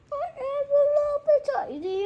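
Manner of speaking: playful small dino voice; tiny dinosaur voice. Pretend. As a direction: squeak it like a tiny creature.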